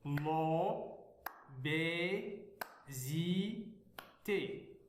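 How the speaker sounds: very slow l'obésité, each syllable held; l'obésité in long, evenly separated syllables. Shown as a demonstration. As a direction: beat out l'obésité as lo, bé, zi, té. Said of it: "lire les mots syllabiquement".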